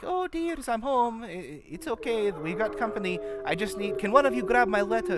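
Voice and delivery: put-on high sweet old granny voice calling from indoors. Granny calls into the house for her letter, high and sweet. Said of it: "you hear Granny call in".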